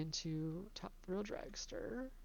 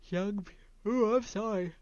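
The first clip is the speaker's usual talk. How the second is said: words breaking into a real yawn; a yawn takes over mid-word; 4 semitones higher, 8 dB louder than usual. Real, not acted. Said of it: "I couldn't fight it any longer".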